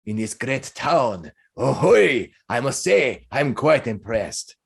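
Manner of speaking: slow, jolly, admiring character voice; effusive host with stretched words; hearty Minsk. Acted. Balding man praises his guest over drinks, admiring. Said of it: "He smiles and he says".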